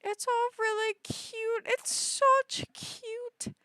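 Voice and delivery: high-pitched coo, drawn out at the end; high gushing squeak. Real, not acted. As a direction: coo over something adorable, high. Gushing over a cute item.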